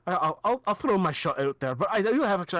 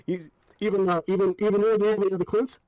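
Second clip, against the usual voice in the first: louder, higher, delighted disbelief; excited guard; 4 semitones higher, 5 dB louder than usual. Acted. Clint guard thrilled by praise, loud and higher. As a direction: gasp out hopeful surprise.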